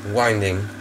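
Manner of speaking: ordinary delivery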